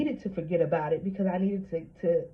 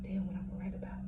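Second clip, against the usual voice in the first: soft, hushed, unsure aside; whispered doubt; 8 dB quieter, faster than usual. Retold or imitated. Answering friends who want a book, in a doubtful whisper. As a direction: mutter it to yourself, doubtful. Retold inner reply.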